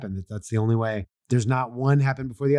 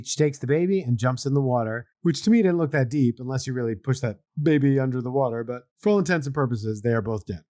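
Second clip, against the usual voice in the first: usual speaking voice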